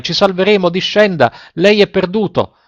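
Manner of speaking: high-pitched urgent pleading call; narrated cry from the sailors; sailors' shout. A narrator's reading. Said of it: "gli gridarono i marinai".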